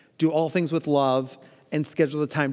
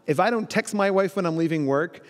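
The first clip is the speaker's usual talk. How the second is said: ordinary talk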